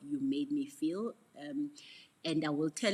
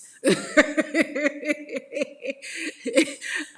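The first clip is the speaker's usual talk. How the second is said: long, loud, high peals of laughter; helpless cackling laugh; big belly laugh; 12 semitones higher, 15 dB louder than usual. Real, not acted. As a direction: crack up completely at yourself. Cracks up at her delayed reaction.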